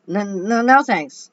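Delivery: loud, high, stammered refusal; stammering. A narrator's reading. Howie backing away from the cookie lady, stammering. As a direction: trip over the words, backing out fast. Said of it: "Howie stammered".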